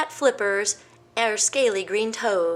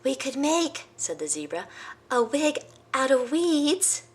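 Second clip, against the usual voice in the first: zebra's idea; 6 semitones higher than usual. Acted. Zebra suggesting a weed wig, high. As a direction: suggest it brightly.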